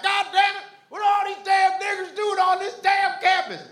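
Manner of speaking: high, loud, hammed-up hillbilly yell. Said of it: "I know they were like".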